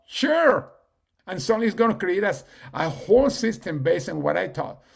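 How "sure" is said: high-pitched willing reply; eager assistant. Retold or imitated. ChatGPT agreeing to use the webinar video, eager assistant. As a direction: imitate an assistant gladly agreeing, much higher.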